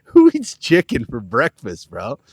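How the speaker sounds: loud disbelieving outburst; can't believe it, shout it; incredulous. Real, not acted.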